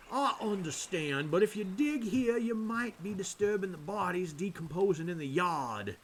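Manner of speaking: drawling Southern priest from Georgia; deep Georgian accent. Acted. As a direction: play a Father with a heavy Georgia accent. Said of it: "the deep Georgian accent".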